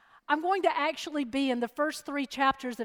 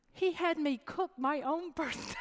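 laughing softly; 6 dB quieter than usual. Real, not acted. Punchline of the birthday prank.

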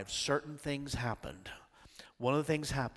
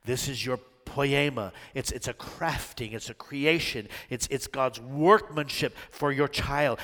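ordinary delivery

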